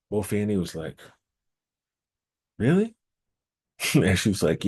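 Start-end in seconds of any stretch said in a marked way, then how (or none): 2.6-2.9 high surprised question. Acted. Mofani doubts Eshu's advice.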